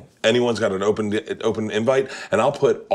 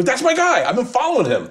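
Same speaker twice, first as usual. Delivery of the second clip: much higher, excited claim; proud fan; 13 semitones higher than usual. Retold or imitated. To puzzled friends about the director, excited pride. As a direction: beam it out proudly.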